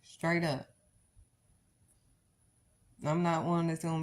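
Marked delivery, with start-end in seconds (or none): none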